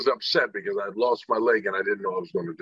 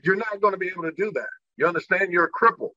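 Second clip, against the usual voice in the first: higher-pitched imitation of a mother; lightly acted parental rebuke, pitched up; retold scolding mother; 8 semitones higher, faster than usual. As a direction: lay down the law as mom, without shouting. Mother scolding her son, retold.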